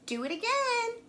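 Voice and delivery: high, slow delighted cheer; high excited cheer. A narrator's reading. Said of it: "but Elizabeth shouted".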